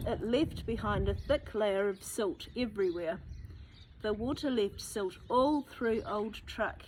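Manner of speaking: usual speaking voice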